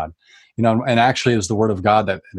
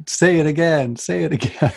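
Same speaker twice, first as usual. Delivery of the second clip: tearful pleading; 7 semitones higher than usual. Retold or imitated. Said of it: "And I just start bawling".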